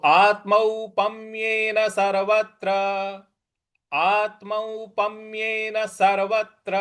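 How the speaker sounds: slow, measured chant of आत्मौपम्येन सर्वत्र; Sanskrit recitation. Shown as a demonstration. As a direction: recite it like a teacher modelling for class. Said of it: "इस प्रकार से बोलेंगे". (धीमा संस्कृत पाठ)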